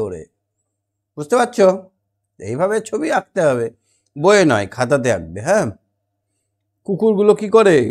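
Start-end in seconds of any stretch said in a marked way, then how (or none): none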